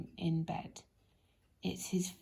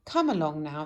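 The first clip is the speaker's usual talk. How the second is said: louder, bustling chivvying; brisk hurry-up; 3 semitones lower, 6 dB louder than usual. Acted. Mister Busy hurrying Mister Lazy, louder and brisk. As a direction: hurry him up. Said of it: "said Mister Busy".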